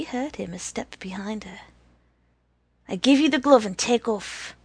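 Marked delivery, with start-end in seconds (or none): 2.9-4.6 loud blurted offer. A narrator's reading. Boy bargaining nervously.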